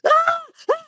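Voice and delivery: high, short scream of fright; re-enacted shrieks from a jump scare; startled yelps. Teacher scared by coworker jumping out, startled yelps.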